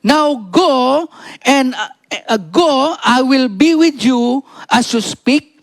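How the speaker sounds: high, slow proclamation of a command; scripture reading. Acted. Reads God's command from scripture. (mataas at mabagal na pagpapahayag ng talata)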